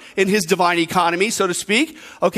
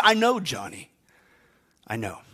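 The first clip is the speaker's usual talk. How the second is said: softly voiced forgiving grandma; gentle reassurance; 9 semitones lower, 6 dB quieter than usual. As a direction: let the second phrase sink very low and soft. Grandma gently forgives the confessing boy.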